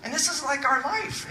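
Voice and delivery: usual speaking voice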